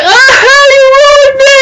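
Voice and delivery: very high, whiny fake crying; mock wail. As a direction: wail it like a heartbroken cartoon kid. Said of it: "They go like".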